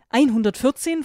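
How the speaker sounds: surprised question. Acted. Girl hears the vampire's age, surprised rising question. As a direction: throw the number back in disbelief. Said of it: "Ich konnte mich gerade noch halten".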